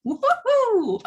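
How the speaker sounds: high, loud mock cheer; woohoo exclamation. A mom reeling from the child's tantrum, loud high whoop. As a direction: let out a frazzled whoop.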